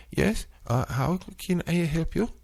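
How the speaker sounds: high, lightly put-on call-center voice; mock customer service greeting; imitating the agent. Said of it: "they talk like this".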